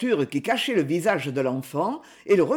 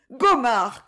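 higher, louder cry of his name; cry for Gomard; 5 semitones higher, 3 dB louder than usual. Acted. Woman cries out to Gomard, alarmed cry. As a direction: call out to him in alarm. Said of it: "cria-t-elle".

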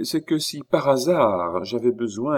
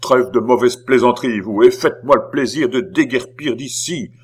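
loud, raised, impatient command; angry order; 3 semitones higher, 7 dB louder than usual. A narrator's reading. Beausire orders the stranger out, angry. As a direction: throw him out with your voice.

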